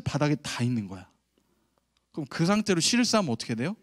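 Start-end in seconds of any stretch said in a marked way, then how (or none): none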